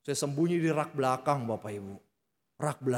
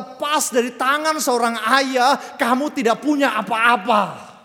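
louder, higher impassioned sermon; preacher at full force; fervent preaching; 8 semitones higher, 9 dB louder than usual. Acted. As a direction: proclaim it to the whole congregation.

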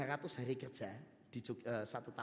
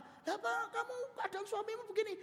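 surprised judge. Acted. Judge questions the wife.

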